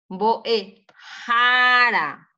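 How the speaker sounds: "Boe, jara" with JA long, high and loud; stress on JA. Shown as a demonstration. Teacher sounding out an example, "jara" with drawn-out JA. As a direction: pause after "Boe", then punch JA in "jara". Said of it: "es aquí el fuerte".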